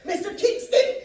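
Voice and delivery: loud, high urgent appeal; panicked plea. Acted. Psychologist begging the husband to act, panicked plea. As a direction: call out for help, high and loud.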